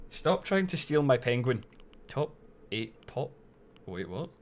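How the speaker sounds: usual speaking voice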